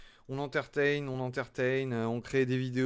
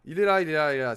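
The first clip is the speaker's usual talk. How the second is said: loud, triumphant repeat of the find; real relief, near shouting; excited; 3 semitones higher, 10 dB louder, faster than usual. Real, not acted. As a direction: blurt it out, thrilled to finally spot it. Finally finds the missing piece.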